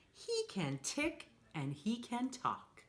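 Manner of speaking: normal talking voice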